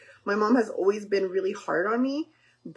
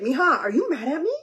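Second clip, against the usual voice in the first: a hint of a hurt father, higher; imitating dad's anxious question; worried dad; 7 semitones higher, 4 dB louder than usual. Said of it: "he would literally call me and be like".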